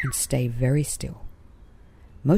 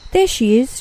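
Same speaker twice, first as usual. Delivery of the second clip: high, louder excited call; excited shout; 9 semitones higher, 5 dB louder than usual. Acted. Paws spotting Blossom in the tree, excited shout. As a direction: point her out, thrilled. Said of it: "shouted Paws with great excitement".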